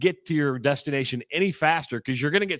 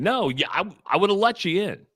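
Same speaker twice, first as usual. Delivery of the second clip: ordinary talk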